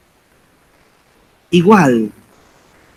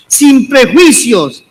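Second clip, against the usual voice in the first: usual speaking voice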